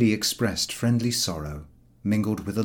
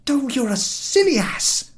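high, louder snappish retort; cutting him short; 5 dB louder than usual. A narrator's reading. George interrupting Harris, sharp and loud. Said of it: "said George, cutting him short".

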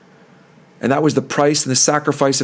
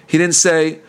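ordinary talk

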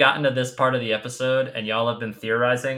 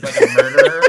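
high, fast, loud laughing squeal; 11 dB louder, faster than usual. Real, not acted. Laughing disbelief.

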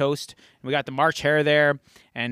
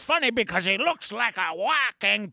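comic sidekick character; 3 semitones higher than usual. Acted. Co-host's character chimes in on the story, put-on voice. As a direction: play an odd, crude sidekick.

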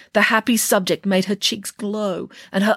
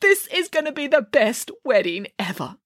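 tears of joy; 9 semitones higher, slower than usual. Acted.

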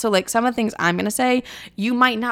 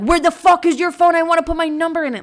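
higher and louder, pushy and fast; mock aggressive demand; 8 semitones higher, 5 dB louder than usual. Shown as a demonstration. Demo of the wrong pushy way to ask for a number, shouted. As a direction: bark it like an overbearing stranger. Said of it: "that's not it".

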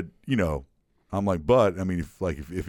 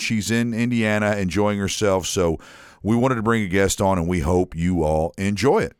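usual speaking voice

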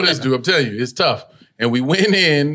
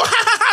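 high-pitched imitated audience laugh; mock laughter. Audience laughs because he smiled at them, mimicked laugh. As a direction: act out a crowd breaking into giggles. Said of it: "And they go".

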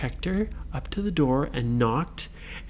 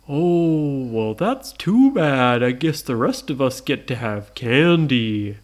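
slow, stretched monster voice; drawn-out, ponderous monster; unbothered Frankenstein; 3 semitones lower, slower than usual. Acted. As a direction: lumber through it with mock regret. Frankenstein reacts.

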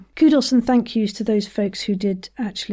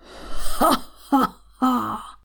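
slow, measured mock laugh; three deliberate ha's from the diaphragm; ha-ha-ha exercise; 3 semitones higher, much slower than usual. Shown as a demonstration. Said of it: "One more".

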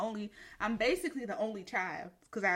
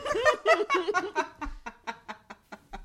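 laughing; 7 semitones higher, 5 dB louder than usual. Real, not acted. Laughter held in during rant.

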